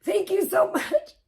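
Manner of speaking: real tearful thanks, voice high and breaking; crying. Real, not acted. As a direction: choke up while thanking them, close to sobbing. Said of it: "you guys are gonna make me cry".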